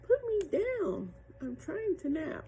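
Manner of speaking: very high, slow, sleepy little voice; a tiny creature grumbling from inside a seashell; tiny shell voice. A narrator's reading. Tiny mermaid in the shell wants to nap, high and slow. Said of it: "I heard a little voice".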